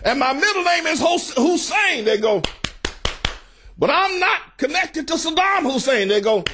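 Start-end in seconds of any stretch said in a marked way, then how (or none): 0.0-2.1 high, declaiming imitation of an orator; mock politician's podium delivery, pitched up; mock Obama speech. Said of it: "I mean, he'd say". 3.8-6.1 high-pitched mock oratory; imitated podium proclamation, pitched well up; mock Obama speech. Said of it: "I mean, he'd say".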